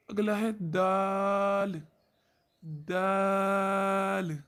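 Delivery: दाल drawn out very slowly, tongue tip on upper teeth roots; slow letter demo. Shown as a demonstration. Teacher slowly demonstrating dal, very drawn out. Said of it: "हर्फ दाल ज़बान की नोक".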